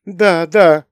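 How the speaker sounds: high, feeble mother's voice; weak voice. A narrator's reading. Mother calls after her departing son, weak voice. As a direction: plead weakly in a high voice. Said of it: "слабым голосом вслед ему сказала".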